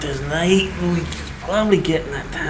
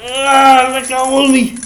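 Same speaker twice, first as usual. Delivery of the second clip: loud, raised yelps of pain; crying out in pain; 3 semitones higher, 5 dB louder, much slower than usual. Acted. Man gripped by robot begs for release, in pain. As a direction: yelp and beg to be released.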